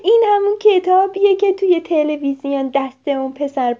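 very high, loud call to a friend; thrilled child calling out; excited shout. Acted. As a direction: yell for him to come look. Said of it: "میکو یهو داد زد".

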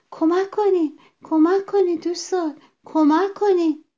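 high, loud, urgent cries; calling for help. A narrator's reading. Rabbit begging quarrelling friends for help, calling out. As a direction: cry out repeatedly to your friends.

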